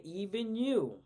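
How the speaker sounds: loud, high, disbelieving even you; shocked question. Retold or imitated. Mom asking her son about swearing, loud and disbelieving. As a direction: demand it in disbelief. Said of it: "And I said".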